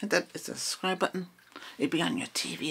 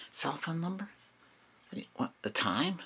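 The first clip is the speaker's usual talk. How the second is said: whispering; 3 semitones higher, 5 dB quieter than usual. Acted. ASMR roleplay whispering.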